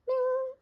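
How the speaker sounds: high sung ding chime; high bell-like ding. Shown as a demonstration. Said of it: "page-turn ding imitated".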